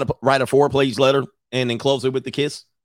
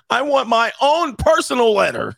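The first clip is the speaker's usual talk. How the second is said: mock whiny wife; 10 semitones higher, 3 dB louder than usual. Melinda voiced whiny.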